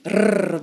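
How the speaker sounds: tongue trill on the gum ridge; short buzzing alveolar trill; rolled R trill. Shown as a demonstration. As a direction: demonstrate a rolled R, brrr. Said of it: "tapping the tip of your tongue in a trill".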